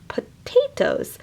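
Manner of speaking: potatoes whispered high and slow. Shown as a demonstration. Reader whispering the word potatoes to children. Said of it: "like pot and ate".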